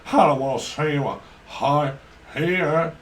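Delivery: loud hammed-up Southern US singer voice; Southern US accent. A Southern US singer refusing to sing, mock Southern US drawl. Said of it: "southern drawl".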